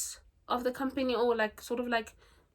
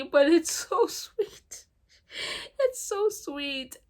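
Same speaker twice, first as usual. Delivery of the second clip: high, slow, laughing gush; higher and slower, giggling at the sweetness; 8 semitones higher, 4 dB louder, slower than usual. Real, not acted. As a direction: melt over how sweet it is. Gushing over romance.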